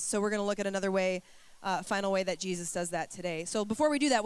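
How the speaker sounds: usual speaking voice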